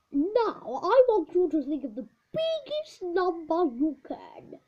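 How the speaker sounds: put-on the old teacher setting a challenge to the class. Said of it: "shouted, continued the teacher".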